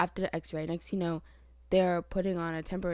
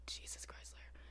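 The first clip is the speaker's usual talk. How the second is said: whispered curse; 18 dB quieter than usual. Retold or imitated. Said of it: "And I'm like".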